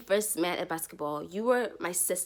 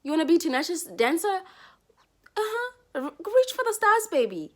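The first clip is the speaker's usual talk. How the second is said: high, excited hype for a sister; cheering friend; 8 semitones higher than usual. Pretend. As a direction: cheer her on like her biggest fan.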